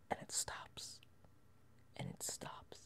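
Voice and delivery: hushed whispered stop. A narrator's reading. The circling noise halts, hushed whisper. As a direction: drop to a breath as it goes still. Sudden silence in cave.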